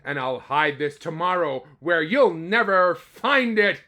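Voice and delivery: eagle calling back. Acted. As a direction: shout it over your shoulder. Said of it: "called back".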